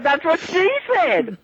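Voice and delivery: high, slow, laughing punchline; slow high laughing quip; amused retort. Retold or imitated. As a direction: deliver the joke through a grin. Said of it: "I was like".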